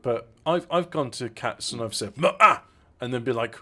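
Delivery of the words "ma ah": loud, forceful Klingon-style cat call; Klingon accent. Shown as a demonstration. Telling co-host how he calls cats, loud Klingon accent. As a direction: bark it out like a Klingon, pitched up. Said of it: "like, a Klingon accent".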